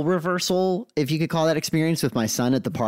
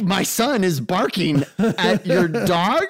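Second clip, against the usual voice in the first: incredulous dad; 4 semitones higher, slower than usual. Retold or imitated. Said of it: "and I'm like".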